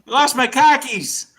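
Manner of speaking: high, loud, slow Boston parody; Boston accent. Acted. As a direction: wail it in broad Boston, dropping the R's. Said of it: "Boston's the only place".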